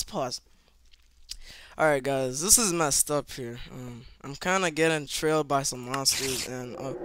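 ordinary talk

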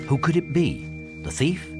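plain reading voice

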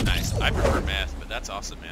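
loud mock-excited boast; loud joking. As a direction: shout the joke proudly. Joking about drugs.

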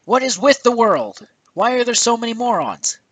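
imitating his own high, loud disbelief; exasperated outburst. What he thought at the store, exasperated outburst. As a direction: act out an exasperated inner rant. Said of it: "I was just like".

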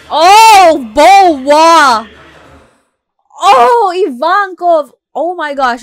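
loud, high fan shouts of delight; excited shouting. Real, not acted. Fan reacting to the video, shouting with excitement. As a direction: shout out each name as they appear. Fan spots favourite characters.

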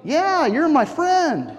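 high, drawn-out buddy reply; easygoing mock answer to a serious question; casual reassurance. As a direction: stretch it out, pitched well up. Peter's casual answer, acted.

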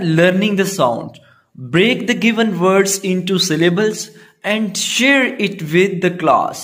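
slow, deliberate English read aloud for pupils; slow textbook reading. Acted. Teacher reading the task to grade three pupils, slow and deliberate. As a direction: read each word out slowly for the class. Reads textbook instruction slowly.

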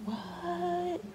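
disbelieving squeak; stunned "what?"; incredulous. Retold or imitated. Incredulous high quiet.

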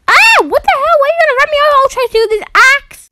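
high, loud alarmed yelling; panicked shout. Real, not acted. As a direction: yell as a car bears down. Car trying to run her over.

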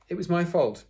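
high, loud schoolboy confession; pleading pupil. A narrator's reading. Sydney taking the blame before teacher, pleading pupil. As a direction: urgently speak up for a friend.

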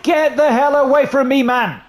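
frustrated gamer screaming at the TV; angry shout. Real, not acted. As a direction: shove the words at the screen like you're being tackled. Gamer yells at onscreen defender.

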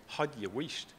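put-on Scottish accent. Son recalls mum hushing noisy kids, soft Scottish accent. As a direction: hush them the way a Scottish mum would. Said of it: "we were told to".

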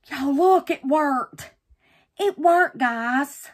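high, loud real excitement; thrilled surprise. Real, not acted. Crafter shows viewers that it worked, thrilled. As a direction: exclaim in triumph to your audience. Basket came out of the mold.

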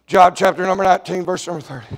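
ordinary talk, nothing marked

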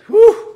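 high, loud exaggerated whew; hammed-up whistle of exasperation; mock whew. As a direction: blow out a big theatrical whew. Reacting to riled-up news emotions.

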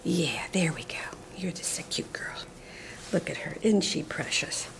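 very soft, half-whispered fondness; soft doting coo. Real, not acted. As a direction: murmur adoringly over the puppy. Cooing to her dog.

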